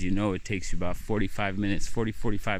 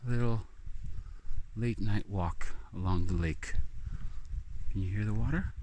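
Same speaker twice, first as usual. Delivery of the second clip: dreamy calm; 6 dB quieter than usual. Acted. Dreamy lakeside walk.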